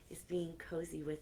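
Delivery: usual speaking voice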